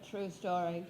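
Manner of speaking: normal talking voice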